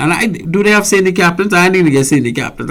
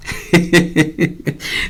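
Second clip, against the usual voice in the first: amused chuckle; 5 dB quieter than usual. Real, not acted. Host recalling a mocking nickname, amused chuckle. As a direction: chuckle to yourself, without words.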